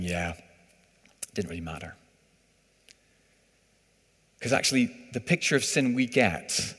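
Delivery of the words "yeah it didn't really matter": lightly voiced, quiet and slow shrug; mild dismissive. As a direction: toss it off softly. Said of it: "and saying".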